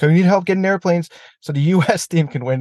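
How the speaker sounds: sarcastic high mock plea with a laugh; mock pleading. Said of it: "like, like".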